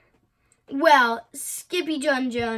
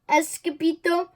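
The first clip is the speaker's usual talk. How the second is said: usual speaking voice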